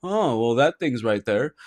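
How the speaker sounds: low, soft, unimpressed remark; casual deadpan, quietly noting it; unfazed. Retold or imitated. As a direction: play it totally unbothered. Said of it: "he doesn't get too excited".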